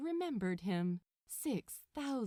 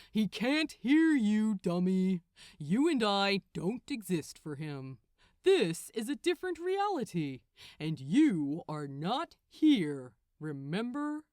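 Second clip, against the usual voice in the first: teasing archangel, louder, a touch of scorn; mocking Gabriel; 7 dB louder than usual. Gabriel tells Crowley the angel can't hear him, mocking. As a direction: poke fun at him, then drag out the reminder.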